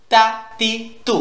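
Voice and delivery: "তা তি তু" short and sharp, each separate; "তা তি তু" with no lengthened vowels; sharp short syllables. Shown as a demonstration. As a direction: say "তা", "তি", "তু" as crisp bursts. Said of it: "যেন আওয়াজটা তীক্ষ্ণ হয়".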